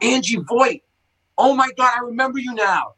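high, sudden burst of recognition, ending in a shout; excited recognition. Retold or imitated. Telling the podcast crew how he recognised Angie, high excited recognition. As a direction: it all comes back at once, blurt it out.

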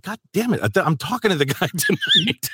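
high, exasperated mock outcry; exasperated outburst. Dad despairing at his teenage daughter, high exasperated outcry. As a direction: throw up your hands at the daughter.

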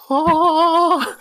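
high, loud gasp of delight; awed gasp. Retold or imitated. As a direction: gasp like a kid seeing paradise. Younger self in awe.

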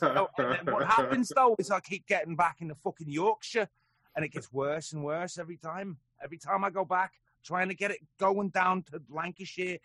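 loud, high Lancashire attempt slipping towards Yorkshire; wobbly Northern English Lancashire accent. Shown as a demonstration. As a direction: try Lancashire and drift into Yorkshire. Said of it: "trying to learn a Lancashire accent".

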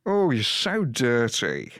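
slow drawn-out comic character voice; Alan Partridge. Acted. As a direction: stretch the oh like a smug presenter. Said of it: "to not recognise that voice".

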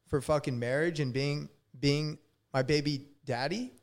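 ordinary delivery

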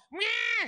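very high wordless mock screech; mock yell. Friend imitates mom spotting her son, high yelling screech. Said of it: "starts yelling".

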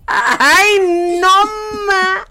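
loud high burst of frustration; exasperated outburst. Real, not acted. Host fed up with fake English, loud outburst. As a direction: throw up your hands in disgust. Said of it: "exasperated outburst".

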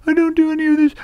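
very high, squeaky character voice; silly high voice. Shown as a demonstration. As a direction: protest in a put-on cartoon squeak. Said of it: "I don't do that voice".

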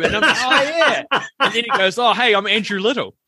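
high-pitched, laughing delivery; cracking up at your own anecdote; laughing while retelling. Real, not acted. Cracks up telling mistaken-identity story.

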